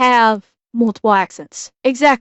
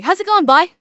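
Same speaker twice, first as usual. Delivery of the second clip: higher-pitched imitation of a Newfoundlander's hello; Newfoundland greeting; 7 semitones higher, 4 dB louder, faster than usual. Streamer showing how Newfoundlanders say hello, imitated Newfoundland accent. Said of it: "when they say hello".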